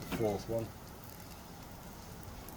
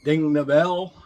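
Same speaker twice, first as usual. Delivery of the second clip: louder, higher push; emphatic call; 4 semitones higher, 9 dB louder, slower than usual. Real, not acted. Vlogger urging viewers to ding the bell, emphatic. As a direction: urge them to hit it. Subscribe request.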